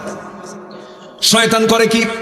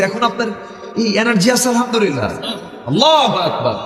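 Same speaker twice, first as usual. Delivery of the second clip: ordinary talk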